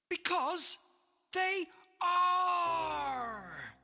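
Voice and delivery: high, loud squawked joke; loud parrot squawk. Acted. Parrot telling the whale a joke, loud squawking. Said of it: "squawk it as loud as you can".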